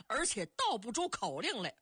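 ordinary delivery

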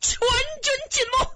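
very high, strained outcry; high-pitched despairing report; anguished cry. A narrator's reading. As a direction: cry out the loss of the whole army. Hero cries that army is lost.

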